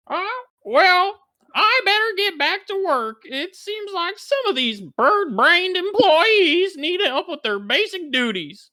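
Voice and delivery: very high put-on mama voice, fed up; exasperated mama.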